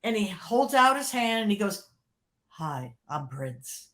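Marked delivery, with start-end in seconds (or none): 2.5-3.9 much lower and softer, slow and calm. Retold or imitated. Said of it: "he talks very soft and very low".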